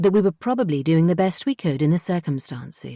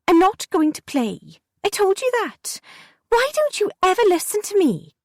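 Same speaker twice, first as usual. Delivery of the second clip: put-on a kid fed up with not being heard; pitched-up exasperated protest; angry girl Jessie. A narrator's reading.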